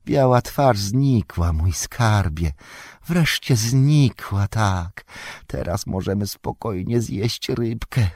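high, slow creature voice with drawn-out vowels; hissy, whining muttering to oneself, stretched and high; Golum. A narrator's reading. Golum alone with his fish, lamenting the lost treasure, high hissing murmur. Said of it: "rozległ się świszczący szept".

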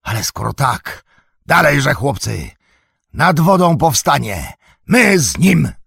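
high, loud battle call; rallying shout. A narrator's reading. Old Cotton rallies his sons to rise, shouting.

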